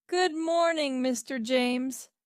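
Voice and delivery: high, cheery greeting; cheerful. A narrator's reading. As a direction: greet the boss cheerfully, voice lifted. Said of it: "said Peter cheerfully".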